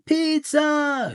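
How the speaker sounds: high, loud hungry chant; shouted pizza. Acted. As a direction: cry out for pizza like a starving herd. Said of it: "shouted the alpacas".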